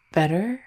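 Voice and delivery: "better" rising at the end like a question; "better" lifted into an unsure question; unintended question. Acted. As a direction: say "better" hesitantly, as if asking. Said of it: "come out like a question".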